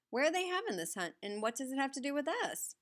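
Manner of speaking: high, eager question; excited. Acted. Wide-eyed boy asks.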